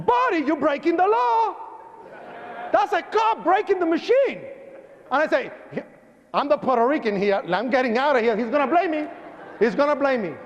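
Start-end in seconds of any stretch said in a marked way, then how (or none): 0.1-4.4 high-pitched mock outrage; comic alarmed yelp, pitched way up; acted alarm. Acts out shock at cop smashing glass. 6.3-10.3 raised, insistent mock worry; higher-pitched fretting; worried. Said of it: "Because I was worried".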